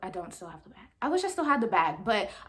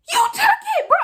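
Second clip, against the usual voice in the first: very high, loud outburst; accusing shout; 8 dB louder, faster than usual. Retold or imitated. Student confronting the boy about the iPod, shouting accusingly. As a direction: yell the accusation in a squealing pitch.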